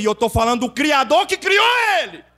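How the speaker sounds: tom agudo que sobe até o brado; proclamação gritada. Retold or imitated. Mesma resposta, repetida aos gritos. (brado crescente de pregador)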